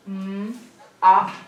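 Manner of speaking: MM and AH as separate sounds; sounded-out letters. Shown as a demonstration. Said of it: "Write the sound you hear".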